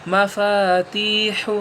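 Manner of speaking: مفاتیح drawn out slowly, final ح breathy; slow blended word. Shown as a demonstration. Teacher blending مفاتیح, slow and clear. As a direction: stretch مفاتیح out. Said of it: "اب رواں کیسے پڑھیں گے آپ؟".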